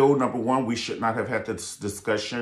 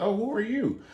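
mock surprise; 10 semitones higher than usual. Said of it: "I'd be like".

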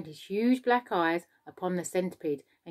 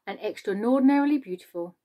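ordinary delivery, nothing marked

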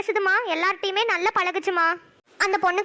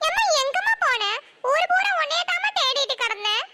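put-on crying child; 11 semitones higher than usual. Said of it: "அழுவாத Nishanthi".

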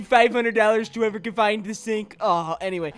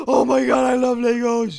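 gasp, then shout with joy; excited gasp; 4 dB louder than usual. Acted. Excited student reacts to Legos.

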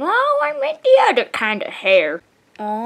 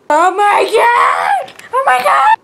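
loud shocked cry; shocked shout; 7 semitones higher, 6 dB louder than usual. Pretend. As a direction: yell it out in shock. Sudden fright in toy play.

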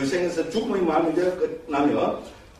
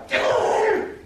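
very high, drawn-out mock cry; spring the punchline in a squeaky-high pitch; playful high exclamation of the frog; comic frog reveal; much slower than usual.

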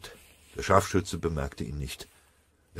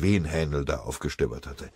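ordinary talk

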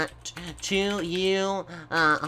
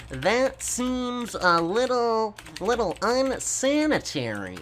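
slowly, higher, dragging each word; stretched typing voice; slow stretched-out dictation; 5 semitones higher than usual. Shown as a demonstration. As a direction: spell it out slowly as he types.

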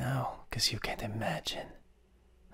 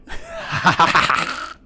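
loud evil cackle; 6 semitones higher, 12 dB louder than usual. Acted. Demon mocking fear.

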